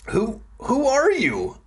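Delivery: high-pitched, startled stammer. A narrator's reading. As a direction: stumble on the first word, voice way up. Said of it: "I stammered".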